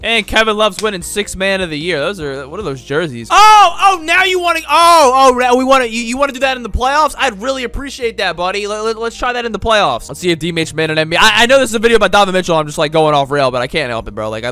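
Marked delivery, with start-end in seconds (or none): 3.3-8.7 taunt. Real, not acted. Taunting the team.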